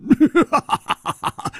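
a big, high burst of laughter; ringing laugh of joy; hearty laugh. Acted. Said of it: "大笑着说".

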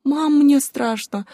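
put-on high, slow little-girl voice; frightened child. A narrator's reading. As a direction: plead in a high, drawn-out child's voice. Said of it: "прижалась ко мне Лиза". (испуганный детский голосок)

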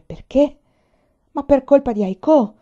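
loud, high-pitched angry retort. A narrator's reading. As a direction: fire the blame back loudly. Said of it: "disse con voce bassa e irosa".

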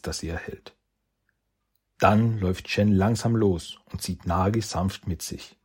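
ordinary delivery, nothing marked

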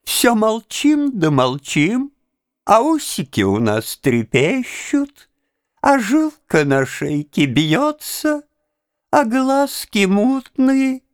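high, slow cooing with lips pushed out; cooing baby talk. Acted. Monsieur Pierre coos at Cincinnatus like at a pouting child. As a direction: coo as if teasing a sulking child. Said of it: "как если бы хотел рассмешить надувшегося ребёнка".

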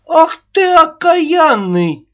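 very high, loud, slow outburst; shrill nagging grandma. Acted. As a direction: explode at him like a furious nanny. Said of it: "Снова не выдержала Аннушка".

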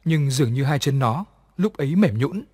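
plain reading voice

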